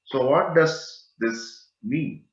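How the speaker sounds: usual speaking voice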